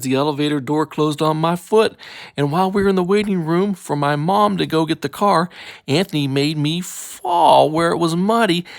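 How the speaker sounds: ordinary delivery, nothing marked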